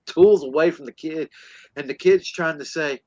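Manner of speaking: usual speaking voice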